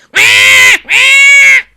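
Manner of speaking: high, loud frightened wah; panicked duck cry; startled duck squawk. Acted. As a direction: squawk as you tumble back. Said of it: "went Missus Mallard as she tumbled back".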